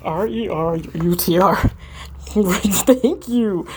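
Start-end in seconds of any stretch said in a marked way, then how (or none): 1.6-2.2 stifled laugh. Real, not acted. Breaks up laughing.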